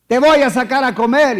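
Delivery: bright, loud promising father; empty promise. Retold or imitated. Father cheerfully promising his child a dinner out. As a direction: promise it big and cheerful, knowing it won't happen.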